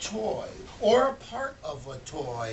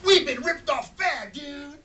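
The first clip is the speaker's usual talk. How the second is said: high, loud indignant dude voice; surfer-dude cat; 9 semitones higher, 8 dB louder than usual. Acted. The cat complaining about the theft, high loud dude voice. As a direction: gripe like a laid-back surfer. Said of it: "which means... in cat language".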